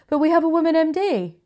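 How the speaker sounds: high-pitched mock company spokesperson; silly put-on voice. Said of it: "I don't know why I did that voice".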